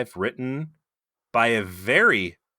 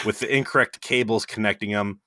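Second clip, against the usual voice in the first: ordinary delivery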